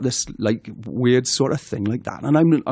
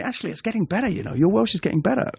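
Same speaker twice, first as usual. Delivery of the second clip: put-on high, lilting Welsh accent; singsong mock Welsh accent; Welsh friends' accent; 8 semitones higher, faster than usual. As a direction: imitate encouraging Welsh friends, pitched up. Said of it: "all my Welsh friends were going".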